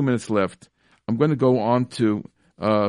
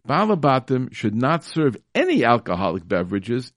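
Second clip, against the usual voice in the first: ordinary delivery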